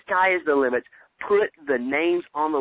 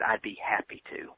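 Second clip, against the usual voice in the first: whispered, softer than his plain talk; quiet whisper; 4 semitones lower, 7 dB quieter than usual. Retold or imitated. The mentor answers his party invite in a soft whisper. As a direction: breathe the mentor's reply as a whisper. Mentor agrees in retold call.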